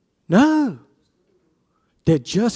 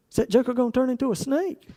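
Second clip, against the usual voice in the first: Moses wondering; 6 semitones higher, 3 dB quieter, faster than usual. Retold or imitated. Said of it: "what does he think?".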